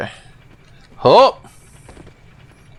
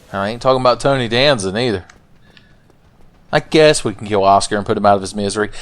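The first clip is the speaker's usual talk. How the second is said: ordinary talk, nothing marked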